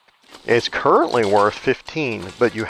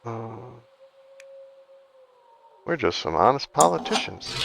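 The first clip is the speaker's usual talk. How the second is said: a lightly put-on smug politician voice, higher; hint of a sly politician, pitched up; mock politician; 7 semitones higher than usual. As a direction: play a lightly voiced crooked politician swearing innocence. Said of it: "I can't even say that without laughing".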